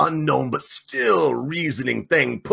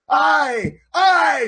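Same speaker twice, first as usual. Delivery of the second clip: much higher, louder cheer of the men; crew shouting; 7 dB louder than usual. A narrator's reading. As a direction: roar back to the captain as a crew. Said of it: "shouted the harpooneers and seamen".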